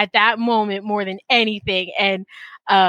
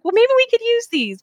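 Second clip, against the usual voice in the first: hopeful bargaining; 13 semitones higher than usual. Retold or imitated. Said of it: "I was like".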